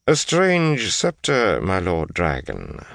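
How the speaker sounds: slow, lilting servant's remark, high at first; lightly voiced Asmodean. Asmodean remarks to Rand on his scepter, lightly voiced and slow. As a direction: draw it out slowly to your lord.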